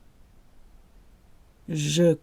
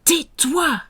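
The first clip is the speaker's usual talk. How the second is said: higher, sharp order; mock stern command; 8 semitones higher than usual. Shown as a demonstration. Teacher acting out tais-toi for learners. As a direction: snap it at an annoying friend.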